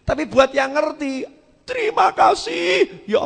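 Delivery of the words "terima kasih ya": much higher, louder and slow; drawn-out cry of thanks. Acted. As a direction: stretch out terima kasih as a joyful cry. Penceramah berseru terima kasih. (seruan terima kasih panjang dan keras)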